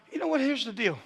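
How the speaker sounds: ordinary talk, nothing marked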